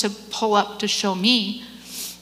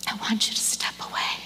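whispered; 5 dB quieter than usual. Retold or imitated. God's words quoted softly.

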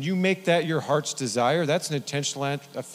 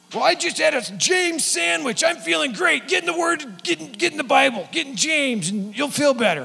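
much higher, louder, hammed-up pep; pep-talk like an overexcited coach; zealous cheerful friend; 13 semitones higher, 5 dB louder than usual. [name] urging his fasting friend to read James, overexcited.

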